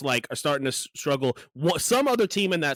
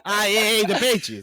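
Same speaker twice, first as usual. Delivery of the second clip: mock Boston accent; 8 semitones higher, 6 dB louder than usual. Mocking friend's Boston accent.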